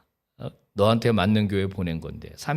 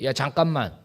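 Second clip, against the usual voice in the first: higher, louder calling out "가지 마"; God calling back; 10 semitones higher, 7 dB louder than usual. Retold or imitated. God calling the departing worshipper back, urgent loud plea. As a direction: call after someone walking away, urgent. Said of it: "하나님이 그때".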